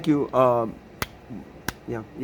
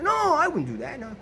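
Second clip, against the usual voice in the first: mock sister; 8 semitones higher than usual. Sister denies it, imitated.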